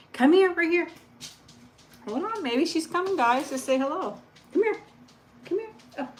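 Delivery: squeaky call to the dog. Real, not acted. Coaxing the dog.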